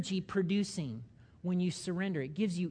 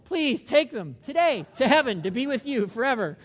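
high, loud, exasperated plea; joking prayer to be rid of people; mock exasperated prayer; 6 semitones higher, 9 dB louder than usual. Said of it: "You might be saying that about people".

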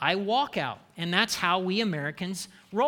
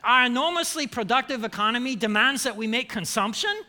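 hammed-up German accent, pitched higher; emphatic fake German accent; 3 semitones higher than usual. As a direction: read it out as a mock German economist. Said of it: "in a fake German accent".

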